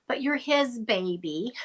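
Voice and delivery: ordinary delivery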